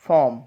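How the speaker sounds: फॉर्म as "fawm", r left out; silent R. Shown as a demonstration. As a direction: model फॉर्म with a long vowel replacing r. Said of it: "silent हो जाएगा". (फॉर्म में आर silent, "फॉम")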